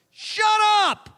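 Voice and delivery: high, loud snapped order; mock shout. Prophet Samuel cutting off King Saul, joking loud shout. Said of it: "He was nicer than that".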